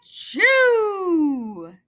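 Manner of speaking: big sneeze. Acted. Bear sneezes in story.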